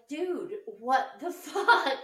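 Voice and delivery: slow, loud laughing outburst; mother confronting her son, laughing and loud; laughing disbelief. Retold or imitated. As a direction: laugh through the exasperated question. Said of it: "I was just like".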